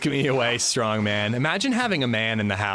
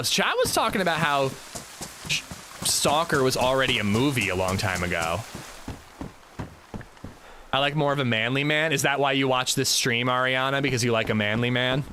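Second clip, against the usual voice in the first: usual speaking voice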